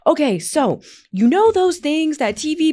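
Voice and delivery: high, slow, fussy over-explaining; rambling old self. Retold or imitated. Host mocking her former self over-explaining to team, high and fussy.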